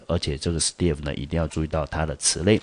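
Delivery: usual speaking voice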